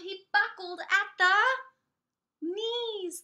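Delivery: put-on lively read-aloud for young children; storybook reading. A narrator's reading. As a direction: read it like story time for little kids. Reads picture book to children.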